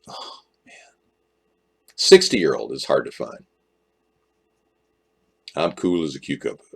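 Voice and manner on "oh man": whisper. Real, not acted. Awed aside at whiskey.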